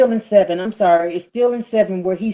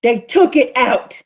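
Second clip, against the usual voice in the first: preaching at full force; 6 semitones higher, 7 dB louder than usual. Acted. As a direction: thunder the scripture over the congregation.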